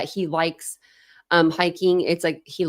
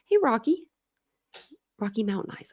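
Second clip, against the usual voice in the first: usual speaking voice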